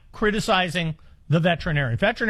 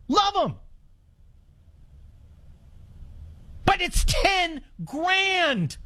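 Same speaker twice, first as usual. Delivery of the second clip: pained; 10 semitones higher, 3 dB quieter, slower than usual. Real, not acted. Love versus cost.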